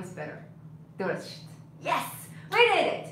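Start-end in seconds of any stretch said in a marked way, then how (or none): 1.8-3.1 high, loud burst of celebration; triumphant cheer. Real, not acted. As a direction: cheer because the glitch is fixed. Celebrates fixing the picture glitch.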